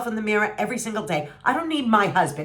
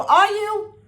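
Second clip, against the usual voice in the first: much higher and louder, challenging the audience; incredulous high repeat; 13 semitones higher, 5 dB louder than usual. Real, not acted. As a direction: throw the question at them, disbelieving. Repeated question, pushed harder.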